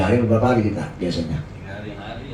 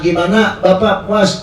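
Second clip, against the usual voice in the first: higher, slow polite trader; 7 semitones higher, slower than usual. Retold or imitated. Trader asks customer if satisfied, higher slow voice. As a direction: salesman fishing for approval.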